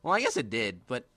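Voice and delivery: normal talking voice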